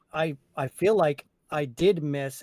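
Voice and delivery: usual speaking voice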